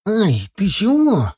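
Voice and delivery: high, drawn-out startled protest; alarmed yelp. A narrator's reading. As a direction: cry out as if grabbed by the collar. Titok grabbed by the collar.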